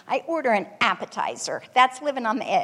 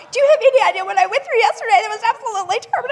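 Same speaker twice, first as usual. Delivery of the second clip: loud, fast and higher, tumbling out laughing; exasperated rant; 11 semitones higher, 8 dB louder, much faster than usual. Retold or imitated. Mom to the pranking clerk, loud laughing exasperation. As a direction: let the whole awful day burst out.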